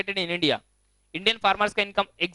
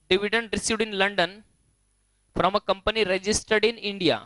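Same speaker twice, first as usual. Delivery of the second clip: slow, measured dictation with a pause midway; slower than usual. Real, not acted. Teacher dictating for students to write down, slow dictation. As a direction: dictate it slowly, phrase by phrase.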